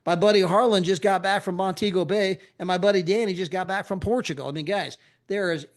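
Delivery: normal talking voice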